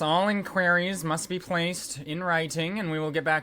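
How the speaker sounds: high, loud bureaucratic drone; officious receptionist. Acted. Receptionist fobs off visitors without looking up, officious.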